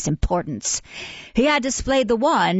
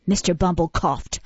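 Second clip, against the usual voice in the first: ordinary delivery, nothing marked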